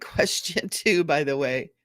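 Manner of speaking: ordinary talk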